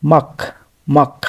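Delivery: মাক closed on ক, with no ল before it; final ক pronounced. Shown as a demonstration. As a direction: model মাক as one short syllable ending in ক. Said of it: "আগে গিয়ক উচ্চারণ". (মাক, শেষে শুধু ক উচ্চারণ)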